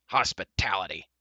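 mildly snappish, punched at the start; snapped. A narrator's reading. Dolly retorting to Adon's thanks for hospitality, snappish. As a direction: toss it back as a curt retort. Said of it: "snapped Dolly".

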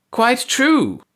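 high, sharp shriek of a voice; shrill it out from behind him; piercing high-pitched reply. A narrator's reading. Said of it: "a voice shrilled behind him".

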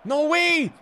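high, loud cry of disbelief; shocked shout. Real, not acted. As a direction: yell it in shock at the game. Opponent makes a play in game.

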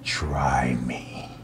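low, breathy mock macho dare; super macho voice. Answering the stranger, a low mock macho dare. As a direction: growl it like a tough guy. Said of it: "in that same super macho voice".

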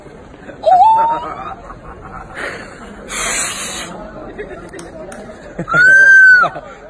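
high shrieking laugh; loud laughing shout. Real, not acted. Laughs after trick reveal.

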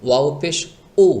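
উ hit with a hard glottal knock; wrong jolted উ. Shown as a demonstration. Teacher shows learners the mistake, jolting উ. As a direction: push উ out with an abrupt jolt. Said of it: "টক্কর লাগিয়ে বা ধাক্কা লাগিয়ে".

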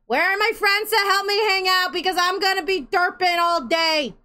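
high, loud call to friends. Real, not acted. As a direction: shout it out playfully. Calling out loudly.

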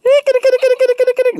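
very high kitty call; sing-song call to the cat. Real, not acted. Calling cat.

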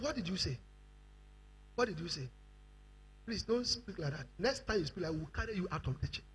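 low, hushed warning; soft, low-voiced threat; quiet rebuke. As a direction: confront them softly and firmly. Acted quiet rebuke by members.

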